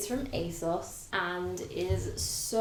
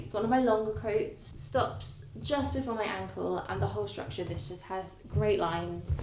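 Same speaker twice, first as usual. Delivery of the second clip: ordinary delivery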